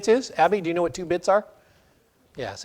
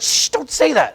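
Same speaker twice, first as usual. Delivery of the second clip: urgent hush; 10 semitones higher than usual. Retold or imitated.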